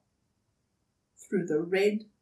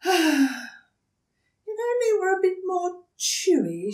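much higher, breathy emu wish; wistful emu; 12 semitones higher, 4 dB louder than usual. Acted. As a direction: sigh it daintily. Emu voice after a sigh.

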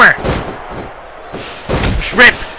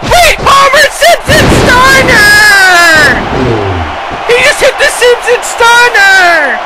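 excited shout; 12 semitones higher, slower than usual. Acted. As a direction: roar the finisher name to the crowd. Finisher move in wrestling match.

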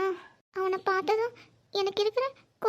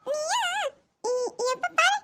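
put-on high, lisping little girl talk; child voice; 5 semitones higher than usual. Little niece teasing, high childish voice. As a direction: play the small niece teasing her aunt. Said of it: "என்ன தமிழ் சொன்ன?".

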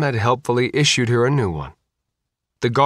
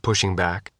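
plain reading voice

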